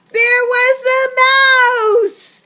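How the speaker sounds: high, loud, slow reveal; spring the surprise on the listeners; surprised exclamation. A narrator's reading. Narrator reveals the mouse is back, high and loud.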